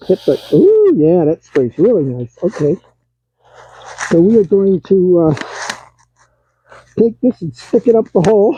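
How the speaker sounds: speaking through a respirator mask; words muffled behind a respirator. Real, not acted. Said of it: "Now I'll be a little muffled with the respirator on".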